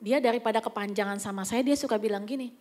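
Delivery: ordinary talk, nothing marked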